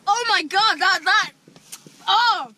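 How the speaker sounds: high loud pained shouts; sour outcry. Real, not acted. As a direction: cry out from the sourness. Four warheads in mouth.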